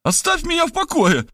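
very high-pitched frantic shriek; panicked squeal. A narrator's reading. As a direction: squeal to be left alone. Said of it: "взвизгнул я, поражаясь высоте своего голоса". (панический визг, очень высоко)

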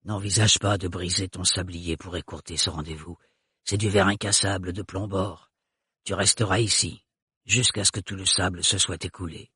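low, soft guttural warning; guttural old woman. A narrator's reading. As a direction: warn her low and soft. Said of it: "avec son accent très caractérisé".

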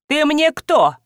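commanding demand. A narrator's reading. Old wife challenging her husband, commanding demand. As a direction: demand an answer. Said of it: "скомандовала старуха с крышкой".

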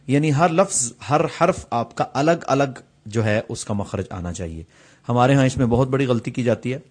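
ordinary delivery

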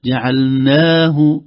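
جعلناه very slow, ha without silah; drawn-out recitation. Shown as a demonstration. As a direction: stretch جعلناه with no waw after the ha. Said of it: "قبلها حرف مد".

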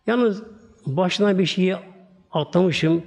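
normal talking voice

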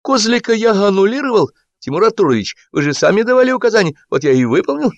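high, glib report to the boss; jaunty reporting. A narrator's reading. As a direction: rattle off the excuse breezily in a high voice. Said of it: "разбитным голосом отрапортовал".

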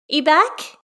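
high, loud call; shouting from another room. Acted. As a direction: call out from the kitchen. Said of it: "A Xiang shouts from the kitchen".

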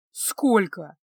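croaked-out question; hoarse. A narrator's reading. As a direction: rasp it, as if weighed down. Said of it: "прохрипел Илья". (хрипло, с тяжестью)

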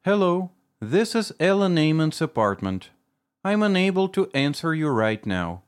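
crisp recorded greeting, every phrase enunciated; answering machine voice. Acted. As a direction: spell out each phrase like a top pupil. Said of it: "Мама чётко, как отличница, выговаривала каждую фразу".

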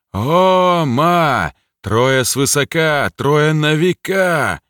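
creaking voice from inside a stone golem; slow stone monotone. Acted. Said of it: "проскрипел его голос из голема".

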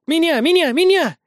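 put-on high, eager crowd of girls shouting; excited shouting. A narrator's reading. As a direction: shout it, jumping for attention. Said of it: "наперебой кричали и прыгали они".

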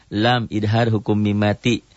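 ordinary delivery

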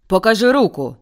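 loud demanding shout. A narrator's reading. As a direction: bark the order at his rival. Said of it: "крикнув він".

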